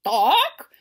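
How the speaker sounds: a loud clucked "Doc"; chicken cluck. Acted. Hen clucking at the window, loud cluck.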